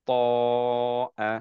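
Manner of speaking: teacher modelling a long madd; four-count madd demo. Shown as a demonstration. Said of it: "এভাবে পড়বো".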